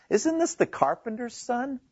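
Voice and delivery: high-pitched, mildly incredulous question; lightly acted disbelief, voice lifted high; amazed questioning. Amazed hometown crowd questioning who Jesus is, incredulous. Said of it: "and they were amazed".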